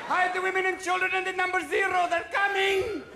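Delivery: high chanted warning; mock alarm cry. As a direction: call it out high across the town. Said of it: "All throughout the Middle East".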